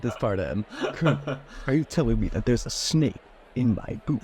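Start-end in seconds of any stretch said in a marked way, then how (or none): 1.7-4.2 Solid Snake voice. Acted. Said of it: "doing the Solid Snake voice".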